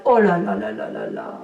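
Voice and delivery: low, amazed oh là là. Real, not acted. Tarot reader amazed at a card for her viewers, low amazed oh là là.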